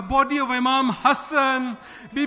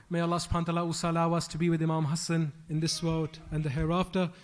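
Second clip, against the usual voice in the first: low, soft, quick dua recitation; closing prayer; 9 semitones lower, 6 dB quieter, much faster than usual. Acted. Preacher closing the majlis with a dua, low and soft. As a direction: murmur the closing supplication quickly.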